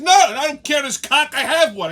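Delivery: loud, high retold outburst; exasperated. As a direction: snap back loudly in exasperation. Exasperated reply to the bartender.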